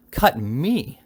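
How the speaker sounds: acted shriek of affront, held in check; mild scream. A narrator's reading. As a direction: throw it back in outrage. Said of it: "screamed the spinster aunt".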